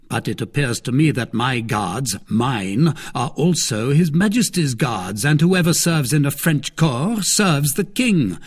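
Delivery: lightly voiced stately cardinal, measured; a hint of a lofty cardinal. A narrator's reading.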